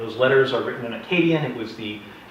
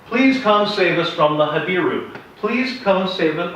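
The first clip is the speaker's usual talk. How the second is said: pleading; 6 semitones higher, 5 dB louder, slower than usual. Retold or imitated. Vassal kings begging pharaoh.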